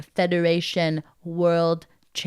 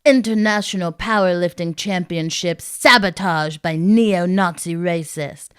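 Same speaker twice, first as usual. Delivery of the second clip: mock news anchor headline; newsreader voice; 5 dB louder than usual. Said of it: "deliver your first news headline".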